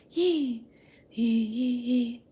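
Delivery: high little squeaks; baby creature squeak. Retold or imitated. Grogu greeting Luke, high excited squeaks. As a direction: squeak like a happy tiny alien. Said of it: "And Grogu going".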